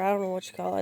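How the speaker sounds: usual speaking voice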